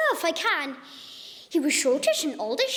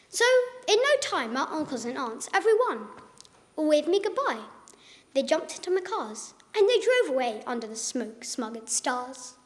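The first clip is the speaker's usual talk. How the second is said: usual speaking voice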